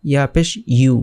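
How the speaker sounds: "ইউ" pronounced yu; corrected y glide. Shown as a demonstration. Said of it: "ইউ হবে".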